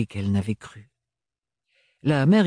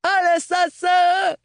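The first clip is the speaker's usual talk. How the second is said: much higher, slow shout for help; 4 dB louder, slower than usual. Acted. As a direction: cry out in panic. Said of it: "un hurlement".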